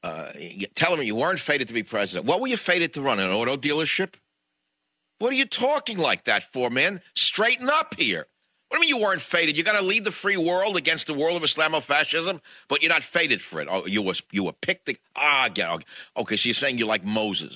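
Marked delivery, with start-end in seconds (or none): 5.2-13.7 higher, louder, hectoring rant; exasperated scolding. Real, not acted. Host berating McCain for sounding weak, exasperated and loud. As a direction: shake him by the collar with your voice.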